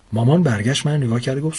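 plain reading voice

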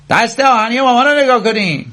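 very high, mocking holler; Scofield's jeering yell; roaring. A narrator's reading. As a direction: bellow it out to ridicule her. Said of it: "نعرهٔ اسکافیلد بود".

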